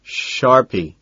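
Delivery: "Sharpie" carefully imitating another's way of saying it; tentative copy of "Sharpie"; copied pronunciation. A narrator's reading. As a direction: try out "Sharpie" slowly as just heard. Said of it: "I try to say it the same way he does".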